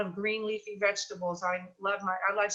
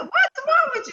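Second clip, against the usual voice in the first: much higher and louder, startled; imitating her own worried alarm, pitched way up; alarmed question; 8 dB louder than usual. Host asking her friend what's wrong, shrill-high alarm. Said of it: "I said".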